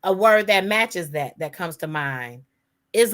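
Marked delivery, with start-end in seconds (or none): none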